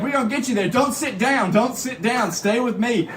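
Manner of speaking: imitated driver taking charge; bus driver. Bus driver urging the passenger.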